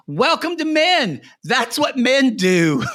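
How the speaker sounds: high, slow, drawn-out holler; exclaimed retort. Retold or imitated. Co-host retelling the retort to a woman on screen, high exclaimed holler. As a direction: holler it as an exaggerated comeback. Acting out own scornful retort.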